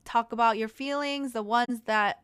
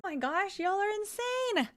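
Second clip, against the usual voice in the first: high, overwhelmed exclaiming; real amazed excitement; 9 semitones higher, 4 dB louder than usual. Real, not acted. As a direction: exclaim in disbelief at a flood of support. Reacts to sudden flood of subs.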